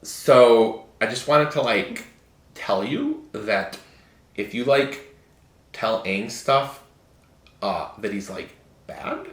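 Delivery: put-on soft, breathy Valley Girl voice; a hint of a Valley Girl. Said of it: "Why are you doing your Valley Girl voice?".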